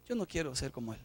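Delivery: low, soft sermon reflection; quiet reflection. Acted. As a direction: draw the lesson gently.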